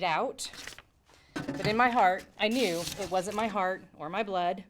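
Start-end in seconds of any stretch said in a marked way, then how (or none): none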